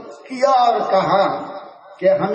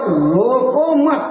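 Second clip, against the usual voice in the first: slow, higher "روکو مت" as one unit; مت joined to روکو; 4 semitones higher, much slower than usual. Shown as a demonstration. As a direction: stretch "روکو" straight into "مت". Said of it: "ادھر روکو سے لگا دو".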